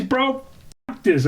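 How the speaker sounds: very high, incredulous customer rant; retold screaming. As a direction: replay yourself yelling at a store manager. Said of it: "I started screaming".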